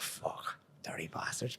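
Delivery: low, whispered swearing under the breath; muttered curse. Retold or imitated. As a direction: re-enact cursing them privately, very softly. Retold cursing of police to himself.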